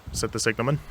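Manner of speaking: ordinary delivery, nothing marked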